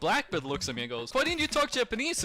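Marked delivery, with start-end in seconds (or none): 1.1-2.2 retold demand.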